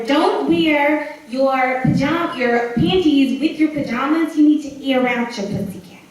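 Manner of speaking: loud, emphatic motherly instruction; mother's admonition. Acted. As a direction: lay down the rule as a mother, loudly. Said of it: "her mother used to tell her".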